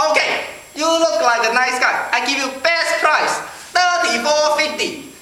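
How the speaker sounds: put-on high Chinese-accented shopkeeper making an offer. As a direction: sell the bargain in a mock Chinese accent. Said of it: "this Chinese guy came out".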